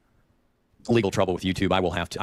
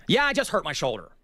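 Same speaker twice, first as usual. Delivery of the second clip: ordinary talk, nothing marked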